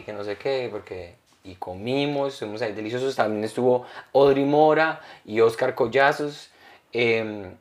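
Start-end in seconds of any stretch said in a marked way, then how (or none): none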